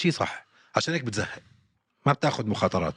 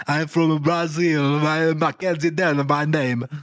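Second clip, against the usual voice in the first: put-on very slow, higher, louder Brazilian-accented English; mock Brazilian accent; 5 semitones higher, 4 dB louder, much slower than usual. Mocking the fighter's switch to a Brazilian accent, slow and louder. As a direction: stretch each word in a put-on Brazilian accent. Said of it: "بتضلها تغير لهجتها بالإنجليزي".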